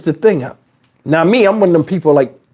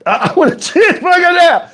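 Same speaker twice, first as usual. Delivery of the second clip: laughing; 12 semitones higher than usual. Retold or imitated. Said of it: "Then I start looking like".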